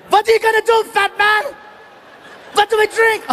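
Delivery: high, defiant imitated German heckler; mock taunting yell. German heckler daring the comic to act, taunting mock yell. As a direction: goad him in a high, mock-angry voice.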